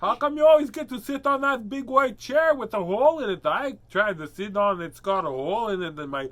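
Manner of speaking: high, loud indignant cat voice; complaining cat. Acted. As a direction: voice a put-out cat griping at its owner. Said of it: "He's like".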